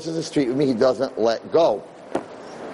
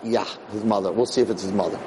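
lower, softer doubting mutter; skeptical aside; 6 semitones lower, 4 dB quieter than usual. Retold or imitated. Storyteller doubting the kid, muttering low and soft. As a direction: grumble it to yourself, unconvinced.

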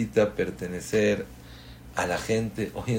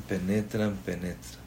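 softer and lower each time; repeated word dying into near silence; fading away; 3 semitones lower, 9 dB quieter than usual. Real, not acted. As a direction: let it sink in quietly.

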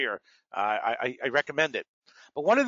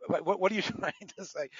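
usual speaking voice

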